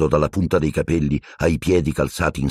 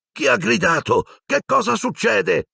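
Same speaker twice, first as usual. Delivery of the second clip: sleepy alarmed voices. Acted.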